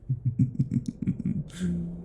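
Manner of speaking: short high gloating laugh; a predator's amused little laugh. Acted. Vampire relishing her captive's heartbeat, high gloating laugh. Vampire savouring victim's blood.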